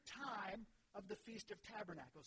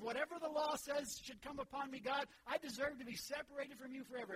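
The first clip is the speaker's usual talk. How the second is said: louder, higher pleading admission; earnest confession; 4 semitones higher, 6 dB louder than usual. Acted. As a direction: pour out the confession to God. Confessing before God.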